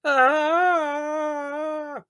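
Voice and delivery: milk the "Ah!" for full drama; dramatic medium's cry. Acted. Medium at the séance milking her cry, high and theatrical. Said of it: "extracting the full dramatic possibilities".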